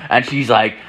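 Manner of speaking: usual speaking voice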